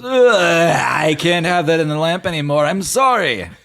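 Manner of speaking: high, loud put-on genie voice. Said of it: "Jeannie just goes".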